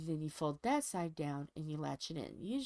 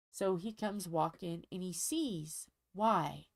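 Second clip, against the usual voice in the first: ordinary delivery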